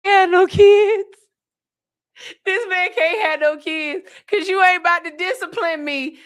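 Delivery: high, laughing through the words; teasing the man from the video while laughing hard. Real, not acted. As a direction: crack up while talking.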